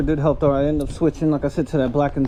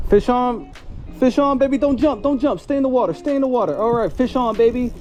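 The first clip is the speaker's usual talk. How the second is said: higher, urgent cries as the fish fights; excited; 7 semitones higher than usual. Real, not acted. Angler to his hooked fish, urgently excited. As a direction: hold it on the line with your voice.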